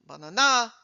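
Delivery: banana with its last syllable pushed up; wrong stress. Shown as a demonstration. Showing the audience a wrong stress: bananA. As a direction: put the stress on the end of banana. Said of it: "are not words".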